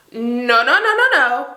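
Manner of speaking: rising, loud no-no-no; loud refusal. Retold or imitated. She refuses her boyfriend's talk-in-the-morning, loud and high.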